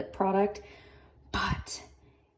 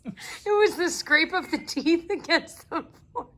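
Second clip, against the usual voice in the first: slow, laughing through the words; 8 semitones higher, slower than usual. Real, not acted. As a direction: giggle while you say it. Laughing at the chewing sounds.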